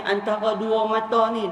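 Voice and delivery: ordinary talk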